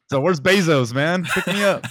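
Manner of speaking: hammed-up castaway plea; mock stranded astronaut. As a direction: call out to space for a rescue. Voicing stranded astronaut bit.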